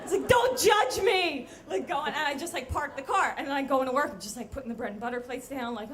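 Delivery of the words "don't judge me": loud, high, slow protest; defensive mock shout. Narrator driving the wrecked car to the street, defensive shout. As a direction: yell it with hammed-up indignation. Driver yells back defensively.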